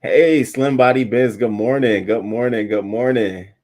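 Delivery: beam out a big welcome; excited greeting. Real, not acted. Greeting viewer in chat.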